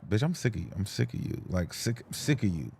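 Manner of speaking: a lightly voiced, soft and low whine at another man; mildly mock the zesty guy; mock whiny man. Lightly mocking a zesty straight boy who is sick of his friend. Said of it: "they, like".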